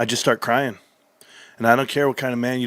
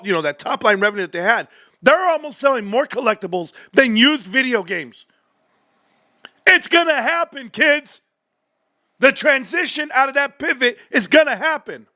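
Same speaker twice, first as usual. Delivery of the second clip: hyped yelling; 10 semitones higher than usual. Real, not acted. Streamer reading GameStop earnings to chat, high hyped yelling. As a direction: cheer over the numbers like a win.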